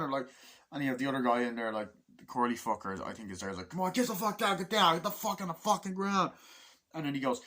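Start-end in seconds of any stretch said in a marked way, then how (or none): 3.7-6.3 high-pitched, frantic commanding yell; re-enacted panicky army command; acted soldier's order. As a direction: order them down in a raised, urgent voice. Acts out another soldier's order.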